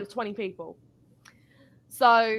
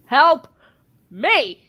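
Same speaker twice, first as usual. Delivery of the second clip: loud plea; 3 semitones higher, 8 dB louder than usual. Retold or imitated. Louder mock cry for help to her supervisor Dan. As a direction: call "Help me" out, pitched up.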